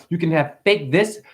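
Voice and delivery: normal talking voice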